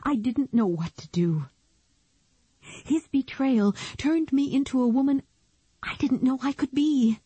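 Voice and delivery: high, hurt, trembling confession; tearful woman's confession. Acted. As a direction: let the hurt spill out. Beth confesses her hurt.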